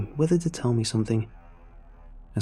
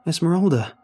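loud, high call for help; raised, anxious cry; shouted; 6 semitones higher, 5 dB louder than usual. A narrator's reading. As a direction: shout the name urgently. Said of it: "I shouted".

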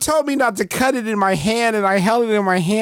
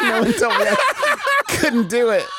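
laughing; 8 semitones higher than usual. Pretend. Rat sniper voice, laughing.

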